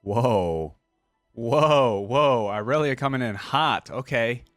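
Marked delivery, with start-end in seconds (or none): none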